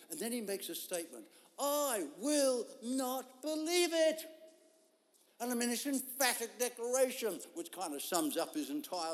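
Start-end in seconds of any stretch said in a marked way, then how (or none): 1.6-4.2 slow stubborn refusal. Retold or imitated. Thomas's emphatic declaration.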